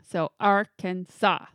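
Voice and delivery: "Arkansas" slowly, stressing AR and SAW; stress first and last. Shown as a demonstration. Demonstrating the legal pronunciation, "Arkansas" slowly with first and last stressed. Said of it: "accent on the first and the last".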